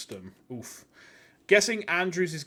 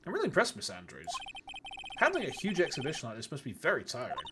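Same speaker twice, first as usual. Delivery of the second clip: reading game dialogue in character voices; voicing game characters; 6 semitones higher, 5 dB quieter than usual. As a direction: act out each character in turn.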